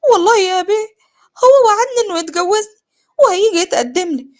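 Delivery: high-pitched, desperate swearing of innocence; tearful pleading. Acted. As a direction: plead with an angry brother through tears. تتوسل لأخيها وهو يضربها. (بتوسل وبكاء)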